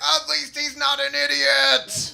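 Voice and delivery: high, slow, amused dad; a father secretly impressed. Retold or imitated. Dad defending his son to mom, high and amused. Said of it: "And my dad's like".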